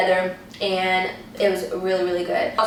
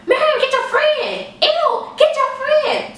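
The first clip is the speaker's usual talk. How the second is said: high, loud panicked screaming of a name; 4 dB louder, slower than usual. Retold or imitated. Roommate out of the bathroom screaming for Miriam, high panicked scream. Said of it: "starts screaming".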